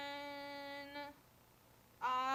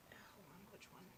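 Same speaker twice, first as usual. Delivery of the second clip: whispered aside to self; whispering; 3 semitones lower, 18 dB quieter, much slower than usual. Real, not acted. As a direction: mutter it under breath. GM whispering to himself.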